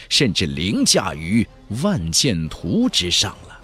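ordinary delivery, nothing marked

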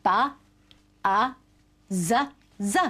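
"ba, a, z, z" as separate letter sounds; sounded out. Shown as a demonstration. Teacher breaking buzz into sounds, "ba, a, z, z" said separately. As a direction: say "ba, a, z, z" singly, with pauses between. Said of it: "Letters tell us what sounds to say".

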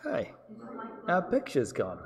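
ordinary talk, nothing marked

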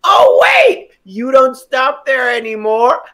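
normal talking voice

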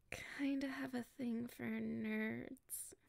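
drawn-out bashful confession, whispered; slow hushed admission; slow shy whisper. Acted. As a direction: confess it slowly under your breath.

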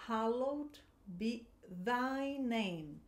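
each word drawn out slowly; teacher pacing a prayer line for learners; slow model reading. Shown as a demonstration. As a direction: read very slowly so students catch the sounds. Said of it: "você vai praticar a pronúncia".

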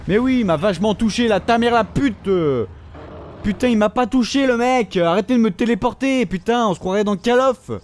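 high, loud sarcastic rant; sarcastic rage. Real, not acted. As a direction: vent at the game, mocking. Raging at game. (colère sarcastique contre le jeu)

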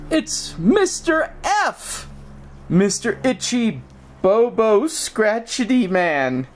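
high, slow cooing pet names; baby talk to pet. Acted. Owner cooing silly nicknames to Fergus. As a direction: dote on the dog with silly names.

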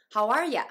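"you" weakened to "ya" in "How are ya"; full loud "are", then a weak "ya"; reduced YOU. Shown as a demonstration. Teacher showing a sound change, "How are ya" with "you" as "ya". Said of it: "Uが音声変化して".

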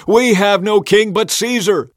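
high, loud retort; shouting back. A narrator's reading. Leading priests answering Pilate, shouting back. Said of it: "the leading priests shouted back".

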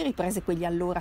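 plain reading voice

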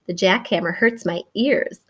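ordinary delivery

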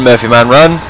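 ordinary delivery

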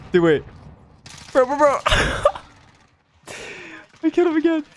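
high, loud rapid yelps; surprised gamer squeal; thrilled at getting the kill again; excited. Real, not acted. Sudden kill in match.

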